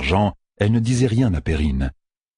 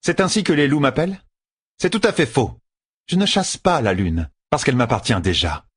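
much higher, proud and mocking; the Forsaken woman, arrogant and amused; haughty Lanfear; 7 semitones higher than usual. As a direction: look down on the wolves' name.

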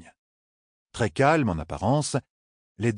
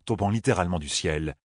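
ordinary delivery, nothing marked

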